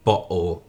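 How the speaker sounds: bottle with the T replaced by a catch in the throat; glottal stop. Shown as a demonstration. Explaining the glottal stop to viewers with bottle. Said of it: "it's called a glottal stop".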